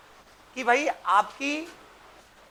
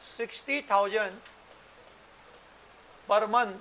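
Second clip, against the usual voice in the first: dictating. Shown as a demonstration. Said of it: "एक question लिख लो बच्चों".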